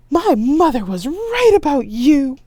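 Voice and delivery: high, loud, slow scandalized wail; dramatic wail. Acted. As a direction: hurl the accusation theatrically. Staged quarrel.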